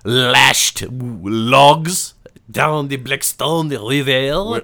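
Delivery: loud, drawn-out mock French; over-the-top French accent. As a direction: belt it out as a hammy Frenchman. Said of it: "best French voice on for this".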